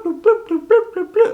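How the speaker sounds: high-pitched bloop, bloop sounds for each brush dab; squeaky bloop noises. Shown as a demonstration. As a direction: chirp bloop bloop like a toy. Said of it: "I don't do bloop".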